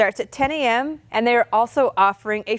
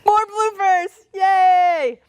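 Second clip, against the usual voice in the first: mock cheer; 7 semitones higher, 4 dB louder, much slower than usual. Real, not acted. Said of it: "mock cheer at bloopers".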